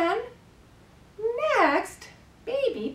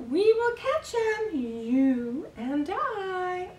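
nursery rhyme performance; 8 semitones higher than usual. Pretend. Carer tickling baby's tummy with a fly rhyme, playful sing-song. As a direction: build playfully towards the tickles.

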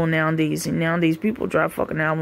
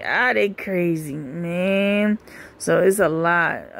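ordinary talk

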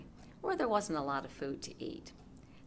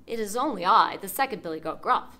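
fairly loud middle goat; louder goat voice; 2 semitones higher, 9 dB louder than usual. Acted. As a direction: speak up, almost troll-loud. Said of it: "almost as loud as the troll's".

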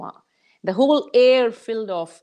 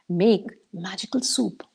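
plain reading voice

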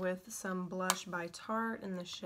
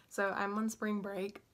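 ordinary talk, nothing marked

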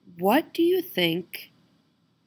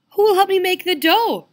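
high, louder hen voice; little red hen voice; 12 semitones higher, 6 dB louder than usual. Acted. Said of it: "she asked".

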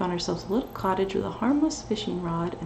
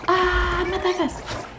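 frantic loud panic; being chased; 8 semitones higher, 5 dB louder than usual. Real, not acted. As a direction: yell in terror while fleeing. Fleeing swarm of Zergs.

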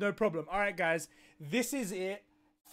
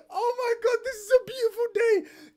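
high, choked-up happy cry; tearful joy; 13 semitones higher, 4 dB louder than usual. Real, not acted. Fan overjoyed at the Snyder Cut, high and teary. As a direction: let the voice crack with joy.

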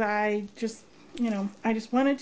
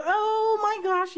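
high, stretched-out delighted cry; excited squeal; 8 semitones higher than usual. Real, not acted. Crafter reacting to her friend's note, excited squeal. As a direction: draw it out high and slow.

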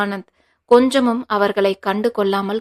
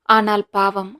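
ordinary delivery, nothing marked

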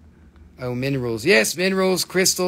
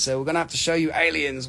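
usual speaking voice

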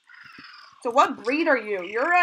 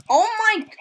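surprised gasp; 10 semitones higher, 5 dB louder than usual. Real, not acted. Sees a lot of eggs.

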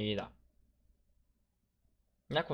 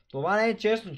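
outraged protest; 10 semitones higher, 4 dB louder than usual. Real, not acted. Protesting a game result.

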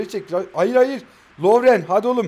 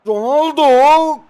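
long drawn-out shout of the name; stretched-out angry call; frustrated yell; 8 semitones higher, 9 dB louder, much slower than usual. Real, not acted. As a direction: bellow the player's name in disbelief. Shouting at the player.

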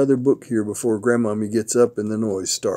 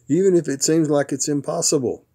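usual speaking voice

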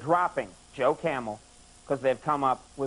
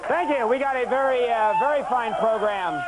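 high, raised voice over a cheering crowd; calling over applause; 10 semitones higher, 4 dB louder, slower than usual. Real, not acted. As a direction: project your welcome above the audience's roar. Host greets a cheering studio audience.

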